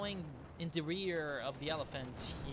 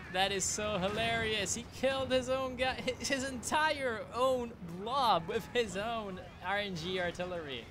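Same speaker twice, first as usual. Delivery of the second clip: amused; 6 semitones higher, 5 dB louder than usual. Real, not acted. Reacts to player killing own blob.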